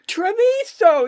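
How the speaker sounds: high, drawn-out holler; mock-shout it like an overeager tourist; imitated shouting. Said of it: "You're shouting".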